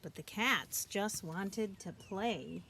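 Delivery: ordinary delivery, nothing marked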